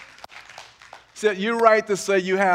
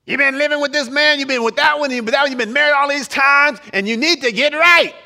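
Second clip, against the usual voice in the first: higher, louder scolding auntie voice; Aunt Esther style; 7 semitones higher, 6 dB louder than usual. Retold or imitated. Preacher mimics Aunt Esther scolding the woman, loud and high. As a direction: scold her like a nosy aunt. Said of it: "you could say that like Esther".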